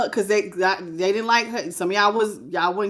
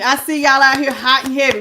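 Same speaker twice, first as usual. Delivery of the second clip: ordinary talk, nothing marked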